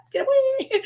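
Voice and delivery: very high, playful get-away squeal; mock disgust. Mom recoils from the baby's mucus, high mock disgust. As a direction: shoo it off in jokey horror. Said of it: "and I'm like".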